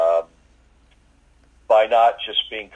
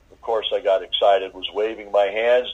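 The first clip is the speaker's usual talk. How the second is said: normal talking voice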